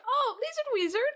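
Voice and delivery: high bright call-out of a chatter's name; excited greeting. Real, not acted. Streamer greeting a chatter, high excited call-out. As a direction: greet him like a friend just walked in.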